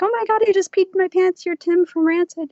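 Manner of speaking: high, loud, breathless fan excitement; starstruck fan gush. Retold or imitated. Fan meeting Tim, gushing high and loud.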